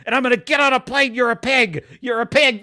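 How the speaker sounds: imitating a father's nasty voicemail; imitated angry rant. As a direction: rant down the phone like a furious dad. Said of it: "he just is ranting at his thirteen-year-old daughter".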